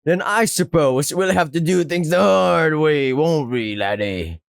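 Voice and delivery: hammed-up pirate menace; pirate villain. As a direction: threaten like a pirate, sinking at the end. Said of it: "like something straight out of a pirate movie".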